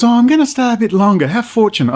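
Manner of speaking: high, sing-song lawyer impression; mocking the lawyer. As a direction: lilt it to mock his smugness. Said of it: "And he goes".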